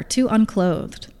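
ordinary delivery

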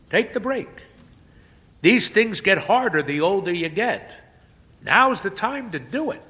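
high, loud brisk advice; encouraging priest. Acted. Priest urging the boy to take a break, high and loud. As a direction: wave him off the hook.

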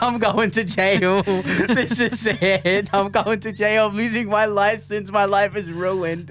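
nearly crying. Retold or imitated. Telling how the drug test scared him, high teary slow panic. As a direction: act out almost bursting into tears. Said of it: "I actually nearly started to cry".

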